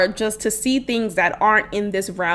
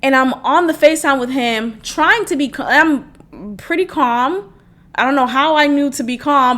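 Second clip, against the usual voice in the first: usual speaking voice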